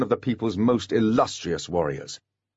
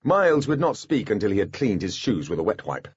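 usual speaking voice

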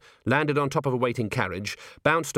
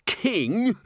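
wheezing; 11 semitones higher than usual. Acted. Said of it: "he wheezed".

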